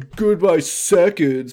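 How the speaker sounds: high, slow whiny moan; whiny lament.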